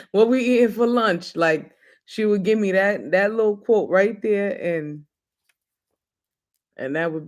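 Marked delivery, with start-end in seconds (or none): 0.0-1.3 laughs mid-line, breezy; a light laughing aside; laughing casual switch. Retold or imitated. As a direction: chuckle through the topic change. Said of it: "and then we'll be like".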